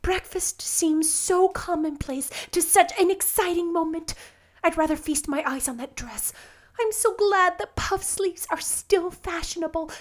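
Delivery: put-on high, gushing girlish chatter; rapturous. A narrator's reading. Anne chatters about the dress and being good, enraptured. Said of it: "said Anne rapturously".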